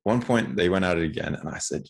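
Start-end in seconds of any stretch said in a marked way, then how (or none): none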